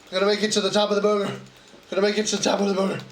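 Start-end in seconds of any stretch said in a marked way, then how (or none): 0.1-1.3 fast gabble. Real, not acted. Said twice, faster.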